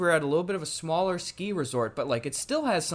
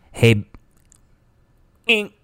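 loud hammed-up hello; mock loud phone greeting; 9 dB louder than usual. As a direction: shout a cheery hey down a bad line. Bad plane wifi call.

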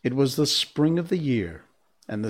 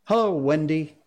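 put-on bright-pitched, louder boyish greeting; casual boy's hello, a little raised; careless boy; 5 semitones higher, 4 dB louder than usual. A narrator's reading. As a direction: greet her loudly, higher, oblivious. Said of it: "not noticing any difference".

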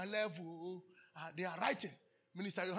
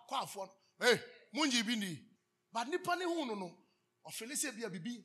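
usual speaking voice

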